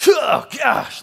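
a high, loud, fed-up groan of "Oh, gosh"; impatient listener giving up on a long sermon; exasperated "oh gosh". Retold or imitated. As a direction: sigh it out like a bored churchgoer leaving. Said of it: "like that".

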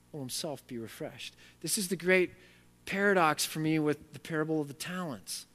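normal talking voice

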